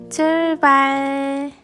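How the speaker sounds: drawn-out cheerful shout of start; excited call. Acted. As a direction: sing it out to the kids. Teacher calls out start.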